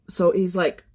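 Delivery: usual speaking voice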